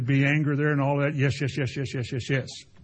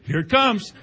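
hopeful; 6 semitones higher, 4 dB louder than usual. Retold or imitated. Wrench about to start.

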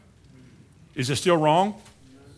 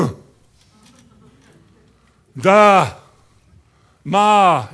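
put-on loud babbled baby sounds; baby babble; 8 dB louder, much slower than usual. Preacher imitating a baby learning to talk, loud babble. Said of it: "They go".

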